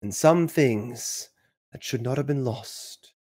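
slow, solemn fantasy-prologue voice; epic narrator. Acted. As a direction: draw it out like an ominous film prologue. Dramatic quote from Lord of the Rings.

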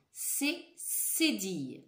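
slow, clear C cédille; slow clear model. Shown as a demonstration. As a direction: say C cédille slowly for them to repeat. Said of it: "Répétez après moi".

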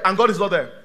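usual speaking voice

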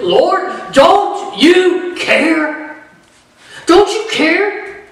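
high, slow, drawn-out plea to the Lord; pleading grieving sister. Retold or imitated. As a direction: stretch each word like a hurt accusation.

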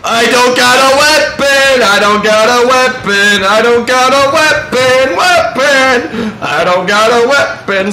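loud, frantic repeated cry of alarm; panicked yelling. Real, not acted. Gamer chased by an enemy without a weapon, panicking. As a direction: yell it while fleeing for your life.

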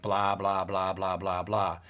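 mock bored dog, letting it fade; dog hearing blah. The teacher voices the dog ignoring small talk, low droning blah. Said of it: "And the dog is like".

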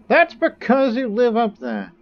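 loud, high-pitched outburst; high mock yell. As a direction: shout it up high in a put-on voice. Mock outburst at game opponents.

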